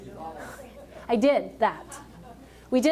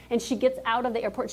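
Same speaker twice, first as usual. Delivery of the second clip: ordinary talk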